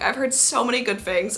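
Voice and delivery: ordinary talk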